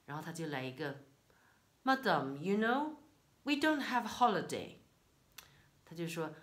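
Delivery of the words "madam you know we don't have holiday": curt English postman, pitched up; mock the postman's brusque English; a hint of a rude clerk.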